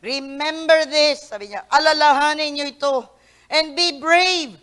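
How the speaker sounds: loud, slow voice of God; booming scripture reading. Acted. Preacher reading God's words from Isaiah loudly. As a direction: declare it with divine authority.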